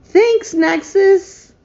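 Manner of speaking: high, slow, gushing thank-you to a viewer; thanking a kind viewer by name, delighted. Real, not acted. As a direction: stretch the thanks out, touched and thrilled.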